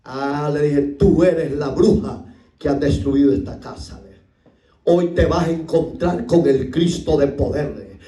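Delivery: ordinary talk, nothing marked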